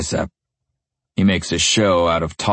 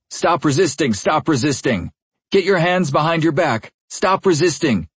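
shouting cop; 10 semitones higher than usual. A narrator's reading. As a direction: bark orders at a suspect. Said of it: "he keeps repeating".

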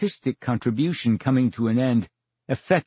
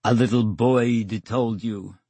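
slow, teasing put-on English accent; playfully mimicking the interviewer's English accent; mock English accent; slower than usual. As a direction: echo her English accent back at her, slowly. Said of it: "in an English accent".

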